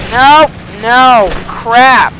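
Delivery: loud, high cries of protest; alarmed shout. Real, not acted. Gamer hit by trouble in battle, alarmed shout.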